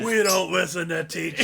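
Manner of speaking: loud rebellious yell; defiant shout. Pretend.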